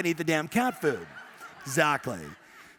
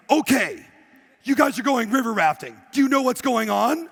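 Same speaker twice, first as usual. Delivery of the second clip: loud, higher imitation of a river guide; punchy, raised-voice retold briefing; mock drill-instructor guide; 4 semitones higher, 6 dB louder than usual. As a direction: call the rafting group to attention. Acts out helmeted river guide briefing.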